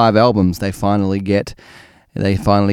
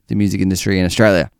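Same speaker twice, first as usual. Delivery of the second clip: ordinary delivery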